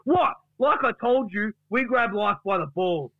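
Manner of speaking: high, outraged mock rant; indignant Duck. Duck snaps back at Gary's questioning, indignant rant. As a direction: leap up in pitch with indignation. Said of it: "And then Duck says".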